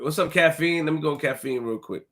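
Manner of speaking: ordinary talk, nothing marked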